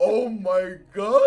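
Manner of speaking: amazed gasp. Retold or imitated. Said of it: "just being like".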